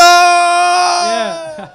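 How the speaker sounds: loud, high shout of yeah; full-throated whoop; big cheer. Real, not acted. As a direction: cheer like a rock crowd. Crowd-style cheer.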